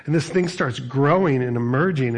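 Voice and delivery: normal talking voice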